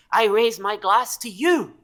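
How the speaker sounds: high, loud, slow-drawn toast; kobold's toast. Acted. Kobold holding up a tankard to a new idol, rousing toast. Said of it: "he holds it up with you, and he says".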